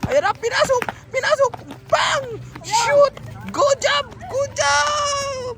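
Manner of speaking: excited goal call. Real, not acted. Commentator praising the shot, excited shout. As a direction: cheer the shot, stretching the last word.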